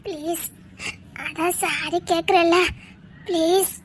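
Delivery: low, drawn-out coaxing; soft pleading. Acted. Girl saying sorry to her angry boyfriend, pleading softly. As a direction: beg quietly, stretching the apology.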